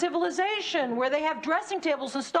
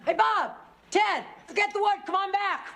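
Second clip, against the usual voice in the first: calling out; 6 semitones higher than usual. Acted. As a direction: shout toward the woods. Calling for missing boyfriends.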